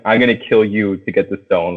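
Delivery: usual speaking voice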